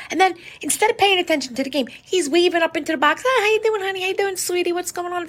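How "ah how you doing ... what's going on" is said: high, sugary mock lover's voice; imitating him calling up to his girlfriend, pitched way up; mocking sweet talk. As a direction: coo it up at the box like a smitten boyfriend. Mocking Kelce waving to girlfriend.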